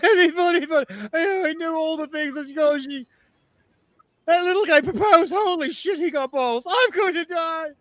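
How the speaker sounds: high, loud, fast panicked wailing; terrified drow shrieking for mercy; screaming drow elf. Acted. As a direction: beg and scream as you're dragged off. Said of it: "as he screams".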